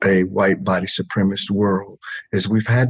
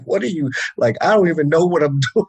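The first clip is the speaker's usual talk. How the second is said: high, laughing, flustered denial; flustered laughing protest; 8 semitones higher, 3 dB louder, faster than usual. Retold or imitated. Telling his wife he doesn't know what he's doing, flustered laughing denial. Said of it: "I'm like".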